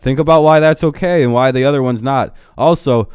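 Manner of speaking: normal talking voice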